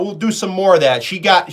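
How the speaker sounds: ordinary talk, nothing marked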